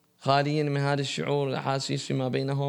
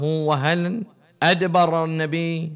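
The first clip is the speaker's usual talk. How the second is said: loud preaching question; 4 semitones higher, 5 dB louder, much slower than usual. Acted. Preacher quoting question loudly.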